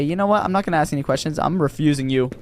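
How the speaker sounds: ordinary talk, nothing marked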